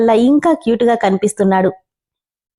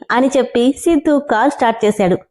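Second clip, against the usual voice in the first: ordinary delivery, nothing marked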